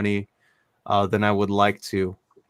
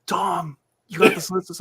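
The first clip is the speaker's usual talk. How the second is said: higher, eager friend's voice calling his name; a caller pitched up and eager; excited friend calling; 8 semitones higher than usual. Retold or imitated. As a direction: play the friend bursting with news. Friend's call, voiced in retelling.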